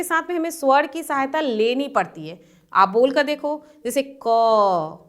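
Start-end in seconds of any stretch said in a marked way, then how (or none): 4.2-5.0 "क" drawn out with an audible अ at the end; अक्षर का उच्चारण. Shown as a demonstration. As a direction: hold "क" long so its inherent vowel rings out. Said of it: "उसमें अ आएगा अंत में". ("क" को अंत के अ के साथ खींचकर बोलें)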